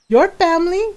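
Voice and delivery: high, loud mom voice; imitated mom. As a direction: pitch it up as an insistent mom. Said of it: "my mom looks at her and goes".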